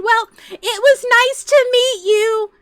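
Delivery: high, loud sing-out goodbye; mock sister's over-sweet dismissal; exaggerated polite farewell, pitched high; fake-polite brush-off. Mimics sister brushing cousin off.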